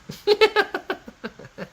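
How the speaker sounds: a long high giggle; high amused cackle; high laugh. Real, not acted. Laugh after reading comment.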